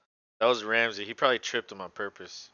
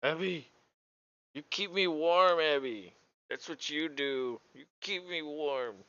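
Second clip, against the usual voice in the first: voicing the dog; 5 semitones higher than usual. Voicing his cold dog asking for warmth. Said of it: "He's like".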